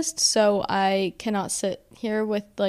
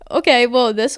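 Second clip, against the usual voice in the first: teary laugh; 2 semitones higher, 5 dB louder than usual. Real, not acted. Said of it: "First time crying on Hot Mess".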